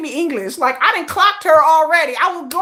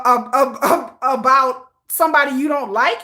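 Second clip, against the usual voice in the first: ordinary talk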